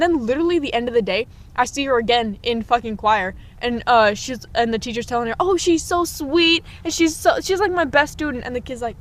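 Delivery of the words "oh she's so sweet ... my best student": gushing teacher. Retold or imitated. Teacher praising a favorite student to class, mock-sweet. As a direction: imitate a teacher fawning over a favorite.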